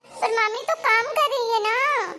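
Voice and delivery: a grandson complaining that aunty is busy; childish whine. Acted. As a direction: whine like a small kid. Child objecting to nani.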